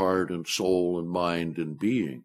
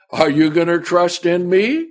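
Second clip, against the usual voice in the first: lightly voiced God, amused and quick; a hint of God gently teasing back; God answering; 9 semitones higher, 6 dB louder than usual. God answering the complainer, a lightly voiced amused challenge. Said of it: "he might say".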